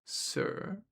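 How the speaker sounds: smooth, understated addition. A narrator's reading. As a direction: slip the word in smoothly. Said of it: "he added smoothly".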